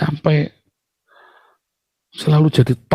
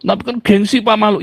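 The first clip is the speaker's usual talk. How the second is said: a high, whiny little protest; mock whiny protest; 11 semitones higher than usual. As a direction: whine it like a proud child caught out. Voicing the proud listener. (merengek tinggi seperti anak yang gengsi)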